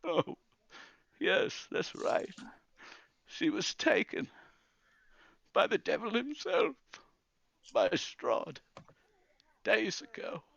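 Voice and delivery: broken phrases on the edge of tears; crumbling grief. Acted. Grandfather recalling Irina taken by Strahd, crumbling grief. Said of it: "crumbles back into a heap".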